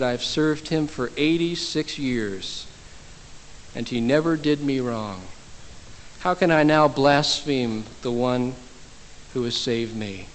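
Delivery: slow, softening, unhurried answer; solemn reply. Retold or imitated. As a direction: let it sink softly to a hushed close. Martyr's solemn reply to his judge.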